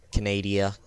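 Canada said as "ca-NAY-dee-uh"; joke mispronunciation. Shown as a demonstration. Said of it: "Yeah, I said it, Canada".

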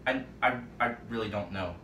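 hesitant stammering, drifting quiet; faltering. Real, not acted. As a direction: trail off unsure, stumbling. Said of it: "why am I stuttering?".

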